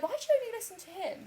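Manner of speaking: high, quick protest; mock indignation. Daughter asking mum why only he counts, mock indignant. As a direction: fire it off high-pitched and indignant.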